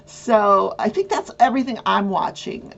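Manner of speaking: ordinary talk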